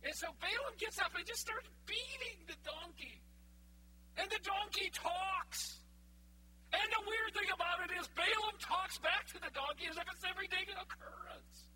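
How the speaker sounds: high, loud, excited preacher build; fired-up sermon telling; preaching at full force. Acted. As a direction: sweep the room up into the story. Preaching climax of donkey story.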